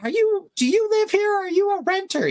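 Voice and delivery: very high squeaky mock lady; boater lady. Lady demanding if he lives here, very high mock. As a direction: squeak it like a nosy shouting neighbor. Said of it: "And she's like".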